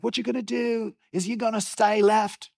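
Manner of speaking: put-on high-pitched, botched Australian accent; hammed-up, shaky attempt at Australian; bad Australian accent. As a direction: badly imitate an Australian raft guide. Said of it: "that was a bad Australian accent".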